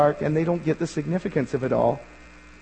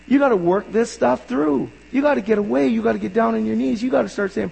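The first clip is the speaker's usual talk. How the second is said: normal talking voice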